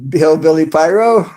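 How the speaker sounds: normal talking voice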